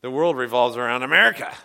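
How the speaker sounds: loud, bossy know-it-all insistence; mock American bluster. A preacher voicing brash Americans, loud and bossy. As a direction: lecture the Australians like you own the world. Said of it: "we're like".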